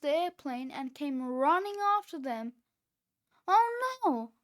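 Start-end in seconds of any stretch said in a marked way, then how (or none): 3.5-4.3 high, loud alarm; frightened cry. A narrator's reading. Cheeseball chased by a small dog, crying out. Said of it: "cried Cheeseball".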